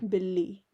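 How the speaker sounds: billi with a long, doubled l; billi, the l twice as strong; doubled consonant. Shown as a demonstration. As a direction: hold the l in billi. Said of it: "lalla twice as strong".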